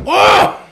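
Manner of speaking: sudden high yelp of pain; mock pained yelp. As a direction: yelp as if hurt.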